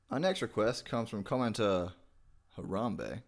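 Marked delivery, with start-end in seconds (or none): none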